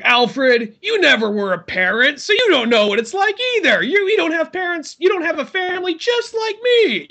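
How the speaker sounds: high, indignant mock rant; Batman. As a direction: throw it back loudly in a strained high pitch. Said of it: "then Batman's like".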